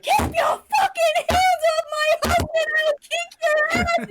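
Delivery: full-force high, loud threat; retold enraged shriek; furious screaming. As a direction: scream it at the top of your lungs. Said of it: "I was screaming at the top of my lungs".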